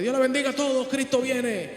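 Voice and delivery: lightly mock the self-announcing churchgoer; a hint of an over-eager hospital visitor announcing his faith; mock preachy greeting. Mocking a visitor's loud greeting.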